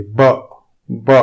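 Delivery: short crisp b; isolated demo of the letter ب; sound ba. Shown as a demonstration. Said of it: "اس کی آواز ب ہوتی ہے".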